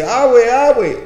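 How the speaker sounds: put-on high, loud child's call; child calling grandma. Preacher imitates granddaughter crying out for her abue. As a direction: call out for grandma like a little girl.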